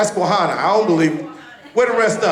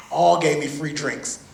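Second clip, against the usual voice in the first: usual speaking voice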